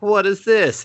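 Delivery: imitating a cop caught off guard; startled question. Cop suddenly finding the device, high, startled.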